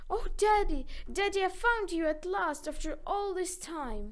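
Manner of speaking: loud, high joyful cry; overjoyed. A narrator's reading. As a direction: call out in delight to his father. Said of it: "overcome with joy".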